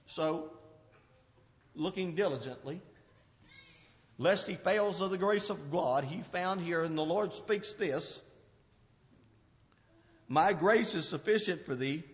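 low, soft reading of the verse; hushed scripture. Acted. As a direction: let the Lord's words come softly.

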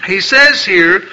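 usual speaking voice

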